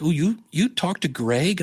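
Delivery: imitated surprise. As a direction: play the mildly astonished coworker. Coworkers amazed he talks to manager.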